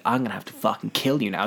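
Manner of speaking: low, soft mock-villain threat; quietly sinister killer, retold; menacing threat. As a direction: play menace under the breath. Villain threatens hero in retelling.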